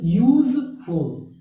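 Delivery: "Useful" slowly broken into यूज, फुल; teacher's slow two-part "useful"; split into syllables. Shown as a demonstration. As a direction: stretch "useful" as two separate beats. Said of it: "इस तरह से".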